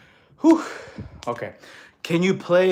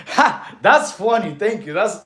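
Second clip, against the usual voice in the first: laughing; 5 semitones higher, 6 dB louder, slower than usual. Real, not acted. Laughs at viewer's joke name.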